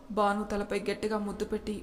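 plain reading voice

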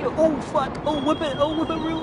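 high, loud excited shouts; hyped up and yelling at the game. Real, not acted. Excited frenzy on the ride.

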